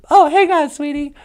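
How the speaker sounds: ordinary delivery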